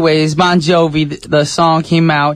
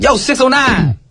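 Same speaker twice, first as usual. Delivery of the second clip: high, punchy area-code call-out; shout-out tag; 9 semitones higher than usual. Shown as a demonstration. Host repping South Jersey on a song, high shout-out. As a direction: throw out a rapper's hometown tag. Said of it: "So I'll be like".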